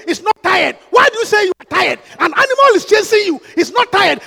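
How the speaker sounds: urgent shouted sermon delivery; preaching at full force. Real, not acted. Preacher urging congregation not to tire when chased, forceful shouting. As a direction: drive each phrase home at a shout.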